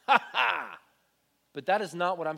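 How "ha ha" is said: gloating taunting ha ha; mocking laugh. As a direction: jeer at the enemy with a loud laugh. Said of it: "rather than say".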